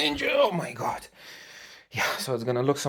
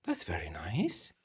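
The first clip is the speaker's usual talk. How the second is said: whisper; 3 semitones lower, 11 dB quieter than usual. Real, not acted. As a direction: whisper so the recording isn't spoiled. Child interrupts filming, hushed reply.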